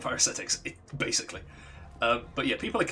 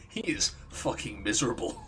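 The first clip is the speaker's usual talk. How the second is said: normal talking voice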